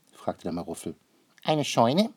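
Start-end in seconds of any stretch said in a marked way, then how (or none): 1.4-2.1 puzzled question. A narrator's reading. Maruffel asks puzzled.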